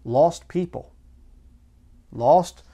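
Lost hit hard and loud; stress on Lost. Real, not acted. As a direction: punch the word Lost. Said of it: "preacher hammers the word".

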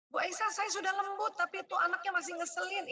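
mock complaining mother; complaining parent. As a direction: protest to the teacher, exasperated. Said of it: "parent complaining to speaker".

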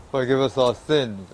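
ordinary delivery

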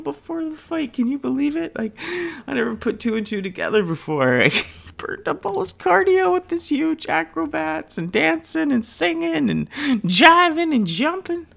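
squealing through giggles, way up high; can barely get the words out for laughing. Real, not acted. Telling her sister's story, cracking up in a high squeal. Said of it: "me and my sister are howling at this".

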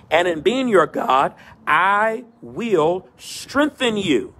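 rising preacher cadence, building phrase by phrase; preaching. Acted. Pastor preaching to his virtual church, in a rolling preacher cadence. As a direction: preach it in a rolling call and response rhythm.

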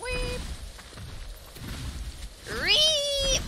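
mock creature squeak; high squeak. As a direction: squeal like a tiny animal.

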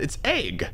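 "egg" said like "ayg"; "egg" rhyming with vague; own pronunciation. Shown as a demonstration. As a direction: insist on "egg" with a long ay vowel. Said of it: "No, that's so bad".

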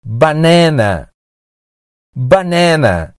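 banana said slowly as ba-NEH-na; slow, clear banana with E in the stressed middle; American pronunciation. Shown as a demonstration. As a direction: model banana for repeating, middle vowel like "net". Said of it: "A maioria dos americanos dizem".

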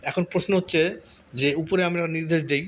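ordinary talk

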